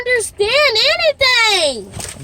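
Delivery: high and loud, hurt and furious; upset yelling. Real, not acted. Upset daughter yelling at parent who doesn't understand her. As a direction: lash out at the parent. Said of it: "You can cry and yell if you want".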